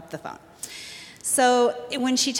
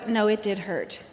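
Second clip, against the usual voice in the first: ordinary talk, nothing marked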